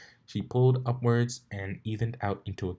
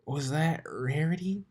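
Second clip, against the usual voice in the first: slow, higher, dazed little dragon; groggy Spike voice, drawn out; dazed mumble; 8 semitones higher than usual. Acted. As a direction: mumble it as if half conscious. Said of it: "he managed to mumble".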